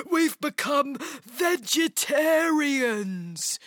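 slow, drawn-out ogre announcement; amused ogre. A narrator's reading. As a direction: proclaim it in a creature voice.

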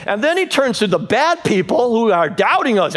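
normal talking voice

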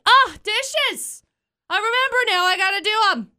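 high, bright sudden remembering; excited realization. Retold or imitated. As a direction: blurt it like a sudden discovery. Acting her sudden realization.

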